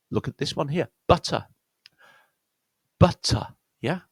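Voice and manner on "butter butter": "butter" with stressed short U, then weak schwa. Shown as a demonstration. Teacher showing another example word, "butter" with stressed short U. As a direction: say "butter" carefully, punching the first syllable. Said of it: "because it's stressed".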